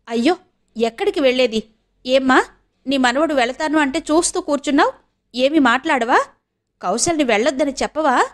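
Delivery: a hint of an aunt appealing for support; protesting aunt. (నిలదీస్తున్న అత్త గొంతు)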